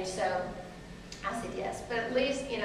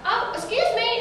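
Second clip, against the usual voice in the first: calling out; 13 semitones higher, 5 dB louder than usual. Retold or imitated. Running after coach, calling out.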